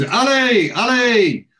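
high, drawn-out imitated shout; hailing shout. Oz hailing Ali across the bar, calling out loudly. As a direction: holler the name across a bar.

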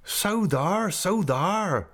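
high, eager rustic cheer; Ham crying out. A narrator's reading. Ham cheering on his uncle, high excited cry. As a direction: chime in high with glee. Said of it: "cried Ham".

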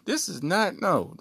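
complaining to his brother; indignant disbelief. Retold or imitated. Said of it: "looking at brother number two like".

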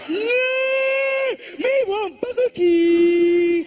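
high, loud, slow brother shout; imitating his brother. Comic brother answering about McDonald's, high slow shout. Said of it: "You want some McDonald's?".

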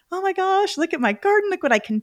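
high, delighted "look at my garden"; gleeful garden joy. Retold or imitated. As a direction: gush over your garden like a proud gardener. Retold joy over her garden.

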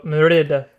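Merida with a tapped Scottish r; Scottish pronunciation. Shown as a demonstration. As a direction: show how a Scot says Merida. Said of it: "I mean, it's Scottish".

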